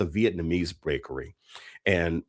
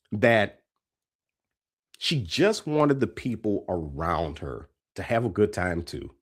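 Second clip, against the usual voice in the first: usual speaking voice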